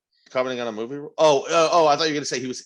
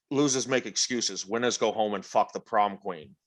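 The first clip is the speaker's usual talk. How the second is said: Sean Connery impression; 4 semitones lower, 5 dB quieter than usual. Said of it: "Sir Sean Connery".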